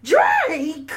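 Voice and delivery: high, loud, incredulous shout. Retold or imitated. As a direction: yell the name in mock disbelief. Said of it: "in my [name] voice".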